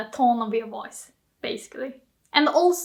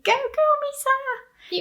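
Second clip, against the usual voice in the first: cheering; 14 semitones higher, slower than usual. Pretend. Cheering translated.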